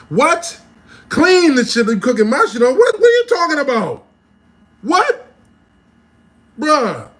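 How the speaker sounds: high, loud, exasperated shouting; incredulous outrage. Acted. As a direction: explode in disbelief. Outraged at never cleaning the grill.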